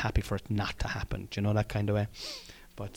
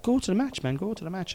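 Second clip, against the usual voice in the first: high-pitched mock wife voice; wife urging him on; 9 semitones higher, faster than usual. Wife tells husband to go to the match, high mock urging. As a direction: imitate an encouraging wife, pitched well up.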